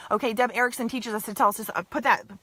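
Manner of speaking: ordinary talk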